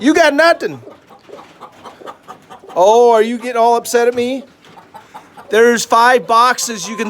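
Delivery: high, loud sweet-talk to a hen; coaxing pet talk. Real, not acted. Coaxing the broody hen Flo.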